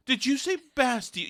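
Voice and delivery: high, smug know-it-all voice; lightly mock a condescending nerd; overconfident nerd voice. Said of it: "this overconfident nerd voice comes from upstairs".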